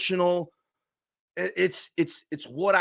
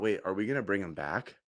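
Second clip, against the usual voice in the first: usual speaking voice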